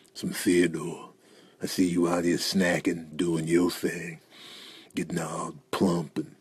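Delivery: low, hushed, slow purr; creepy seduction. Acted. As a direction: savour each phrase with hungry longing. Seductive aside to Theodore.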